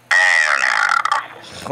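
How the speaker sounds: very low, slow Skeletor growl. Pretend. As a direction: drop into a deep villain voice. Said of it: "Oh, Skeletor".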